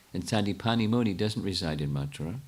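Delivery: ordinary talk, nothing marked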